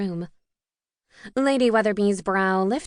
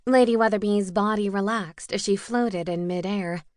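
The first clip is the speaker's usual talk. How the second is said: ordinary delivery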